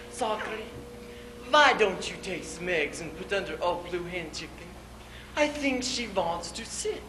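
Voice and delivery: a soft, gentle suggestion as the wife; wife coaxing. Acted. Wife asking husband to set eggs under hen, softly coaxing.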